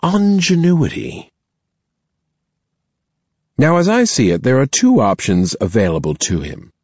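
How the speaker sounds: a headmistress weighing her words; stern principal voice. Acted. Principal continues.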